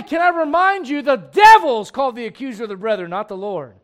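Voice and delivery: high, loud pulpit preaching; fiery preaching. Acted. Preacher rails at the congregation about judging. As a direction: preach it out full force.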